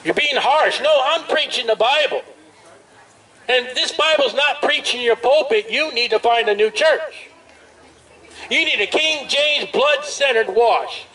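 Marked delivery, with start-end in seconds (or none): none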